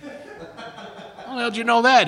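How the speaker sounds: high, slow, laughing disbelief; stunned and laughing, can't believe it; amazed laughing question. Retold or imitated. Stunned when a stranger guesses his car, laughs high and slow.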